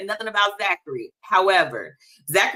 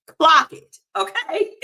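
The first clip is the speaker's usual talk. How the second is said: sassy; 10 semitones higher, 3 dB louder, slower than usual. Real, not acted. Playful sassy aside.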